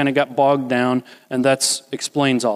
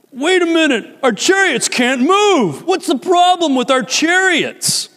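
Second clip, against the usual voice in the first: higher, confused and anxious; mock baffled panic; alarmed Egyptians; 12 semitones higher, 3 dB louder than usual. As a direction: soldiers realising wheels won't turn. Egyptians find stuck chariots.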